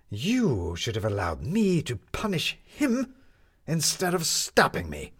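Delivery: slow, aggrieved man; a hint of an indignant gentleman; lightly voiced Jefferson. A narrator's reading. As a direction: Jefferson reproaching her.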